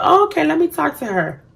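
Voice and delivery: sweet high voice. Retold or imitated. Quoting own sweet reply.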